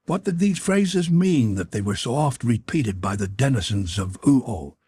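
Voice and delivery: usual speaking voice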